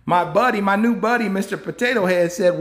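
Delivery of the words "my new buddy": ordinary talk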